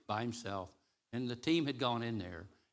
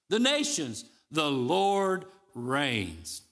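declaimed scripture; 8 semitones higher, 8 dB louder than usual. Acted. Preacher reading the text to the congregation, declaimed at full force.

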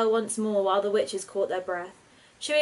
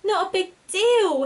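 much higher, louder and slow squeak of disbelief; 11 semitones higher, 6 dB louder, slower than usual. A narrator's reading. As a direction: squeak it out in shocked indignation. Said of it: "Hetty squeaked".

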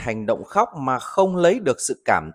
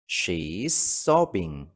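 slow model reading; 6 semitones lower, 4 dB quieter, much slower than usual. Shown as a demonstration. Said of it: "Ví dụ, cô ấy đang khóc nức nở".

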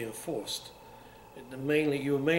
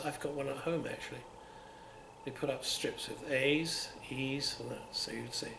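ordinary talk

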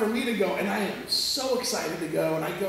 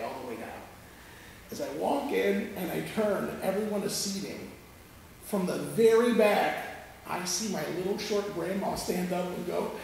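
usual speaking voice

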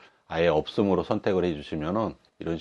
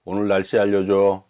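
talking to voice assistant; 8 dB louder than usual. Real, not acted. Commanding the phone assistant.

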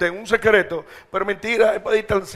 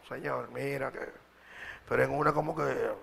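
much softer, lower start to a prayer; soft opening prayer; 5 semitones lower, 9 dB quieter than usual. Shown as a demonstration. Preacher showing how he starts praying, softly. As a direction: begin gently, almost to yourself. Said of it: "Yo arranco a hablar con Dios".

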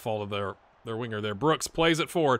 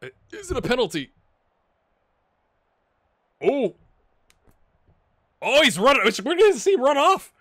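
high, loud excited outburst; rising excitement watching the replay; 11 semitones higher, 10 dB louder than usual. Real, not acted. Excited reaction to penalty drama.

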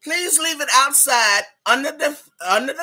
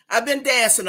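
usual speaking voice

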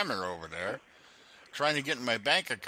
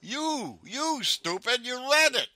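angry yelling; 9 semitones higher, slower than usual. Real, not acted. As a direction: yell it at him, really fed up. Angry caller accuses the agent.

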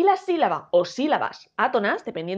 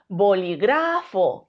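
slow "bolígrafo" with long GRA; bo-li-GRÁÁ-fo; stress on third syllable; 4 semitones lower, much slower than usual. Shown as a demonstration. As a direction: hold and hit GRA in "bolígrafo". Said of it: "Si creemos que es gra".

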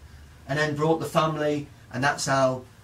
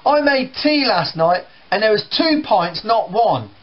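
higher, louder irritated brother; annoyed teenager; 7 semitones higher, 4 dB louder than usual. Retold or imitated. Brother irritated at breakfast, higher and louder. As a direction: snap back, fed up. Brothers quarrel in acted scene.

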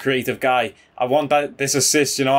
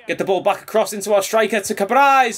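higher, urgent call for the cross; excited calling for the ball; 7 semitones higher than usual. Real, not acted. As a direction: yell at the screen to get it to Cabrais. Urging teammate during attack.

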